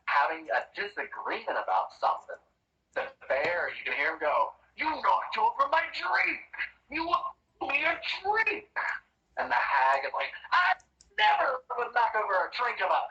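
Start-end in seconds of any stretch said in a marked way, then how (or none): 4.7-8.7 high, shrill-pitched yelling bear demanding his drink; angry bear. Acted. Bear snaps at the hag over his spilled drink, high and indignant. 10.5-13.0 put-on high, offended old hag protesting; indignant hag. Hag denies knocking over the bear's drink, high and huffy.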